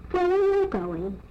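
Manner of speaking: lightly voiced deer asking; high deer voice. A narrator's reading.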